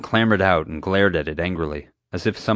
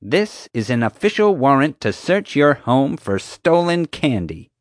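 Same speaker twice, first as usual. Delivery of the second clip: higher, louder official proclamation; self-important; 7 semitones higher, 4 dB louder than usual. A narrator's reading. Guard presenting a search warrant to the family, self-important. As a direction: announce it like a grand decree.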